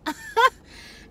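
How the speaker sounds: very high squealing laugh; sky-high delighted squeal of laughter; shrieking laugh. Real, not acted. Laughing at the memory.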